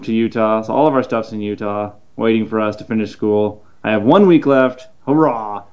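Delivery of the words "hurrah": normal talking voice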